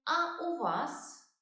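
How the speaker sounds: slow model phrase. Shown as a demonstration. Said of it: "you could say".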